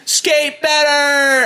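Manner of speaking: high and slow, stretched; high drawn-out. Retold or imitated. Stretched final repeat.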